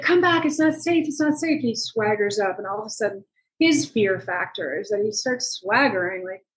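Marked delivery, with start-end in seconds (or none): 0.0-1.6 urgent call. Retold or imitated. Calling Juan back to safety.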